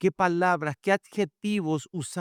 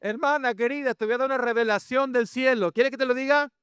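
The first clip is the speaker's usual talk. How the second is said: high-pitched preacher's exclamation; exclaiming; 9 semitones higher than usual. Real, not acted. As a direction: rouse the congregation with a raised pitch. Exclaims, teases congregation with revelation.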